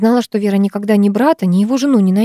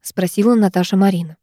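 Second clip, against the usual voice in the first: ordinary delivery, nothing marked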